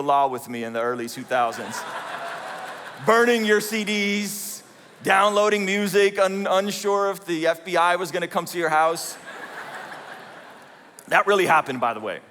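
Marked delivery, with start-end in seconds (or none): none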